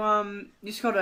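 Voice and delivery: chatty British reassurance, a little brisk; improvised British character voice; British accent. Acted. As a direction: play a friendly Brit brushing off an apology. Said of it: "Mallard and Shallard will have British accents".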